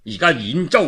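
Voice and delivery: plain reading voice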